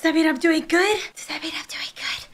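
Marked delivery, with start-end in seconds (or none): 1.2-2.3 hushed unvoiced whisper. Real, not acted. Gamer whispering while hiding. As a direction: whisper so it won't hear.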